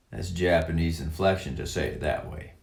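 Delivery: ordinary talk, nothing marked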